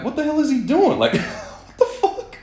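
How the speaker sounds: half laughing at the absurdity; squeaky outraged what the hell; incredulous. Retold or imitated. Recalling mechanic grabbing his phone.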